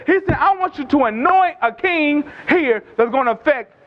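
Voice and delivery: high-pitched, insistent sermon delivery; impassioned preaching. Real, not acted. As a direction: drive each point home to the congregation. Preaches with rising intensity.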